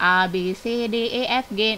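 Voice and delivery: A, B, C, D, E, F, G recited as ah, beh, cheh, deh, eh, ef, geh; Indonesian letter names. Shown as a demonstration. Teacher giving an example of the Indonesian alphabet, Indonesian letter names. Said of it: "kalau alfabet dalam bahasa Indonesia".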